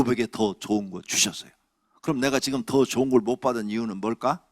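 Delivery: usual speaking voice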